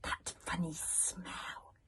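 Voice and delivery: slow hissed whisper; a slow, sniffing whisper. A narrator's reading. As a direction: hiss it slowly under the breath. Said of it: "hissed Tiffin Storm".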